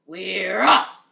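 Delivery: loud joyous pirate cheer; rowdy crew yell; crew shout. Acted. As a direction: shout it as the ship sets sail. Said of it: "we all shouted".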